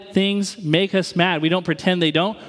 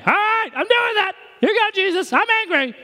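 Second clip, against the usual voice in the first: much higher, put-on goofy voice; mock cheesy voice. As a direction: ham up a naive believer handing it over. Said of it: "I'm just gonna be like".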